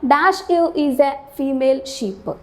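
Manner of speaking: reading the question slowly, word by word; slow quiz read. Shown as a demonstration. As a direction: dictate the sentence slowly. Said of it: "നോക്കാം". (ചോദ്യം സാവധാനം വായിക്കുക)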